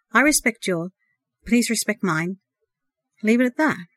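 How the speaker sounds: usual speaking voice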